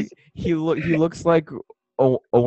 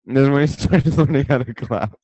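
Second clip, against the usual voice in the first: loud, higher, laughing through it; laughing echo; 4 semitones higher, 6 dB louder than usual. Real, not acted. Friend repeating the clapping joke back, laughing. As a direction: repeat it while cracking up.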